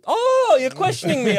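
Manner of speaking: high mock-indignant "oh"; hammed-up divine challenge to a doubter; God taking offence. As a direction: play an affronted God, starting way up high. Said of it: "He was like".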